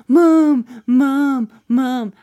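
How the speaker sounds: mock the son's urgent calling; calling out Mom. Son calling his mom over to see the problem, urgent call.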